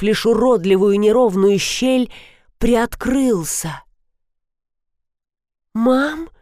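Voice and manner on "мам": ordinary delivery, nothing marked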